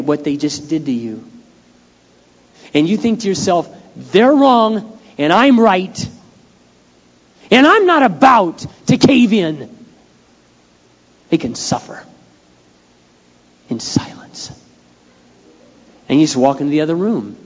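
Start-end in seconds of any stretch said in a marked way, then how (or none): 3.9-9.7 loud, slow, high-pitched stubborn self-righteousness; stubborn defiance. Retold or imitated. Voicing his stubborn inner thought. 11.3-14.5 a sly whisper; whispered aside. Retold or imitated. Mock revenge wish.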